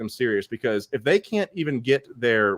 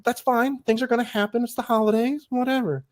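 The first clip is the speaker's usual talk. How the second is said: mock breezy; 11 semitones higher than usual. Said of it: "So I'm like".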